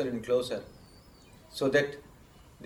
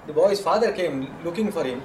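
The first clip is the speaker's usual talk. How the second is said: usual speaking voice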